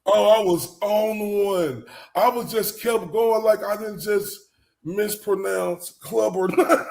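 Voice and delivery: high, slow words through laughter; can barely get it out laughing. Real, not acted. To the O G after the name blunder, laughing hard.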